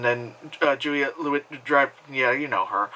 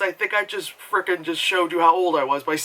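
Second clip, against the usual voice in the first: usual speaking voice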